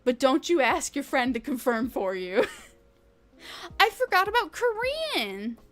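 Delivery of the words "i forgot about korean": high giggly realisation, laughing through it. Real, not acted. Streamer laughing at forgetting Korean, high and giggly.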